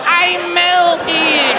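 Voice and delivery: high, slow wail; mock melting. Bitten audience member wailing as if melting away. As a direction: stretch it out like a dying witch.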